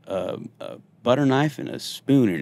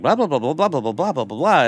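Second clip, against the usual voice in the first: mock chatter, voiced higher; blabbering; 7 semitones higher than usual. Class clown rambling at early briefing, mock blabber pitched higher. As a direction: rattle off blah blah like a class clown. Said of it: "I'm just in there like".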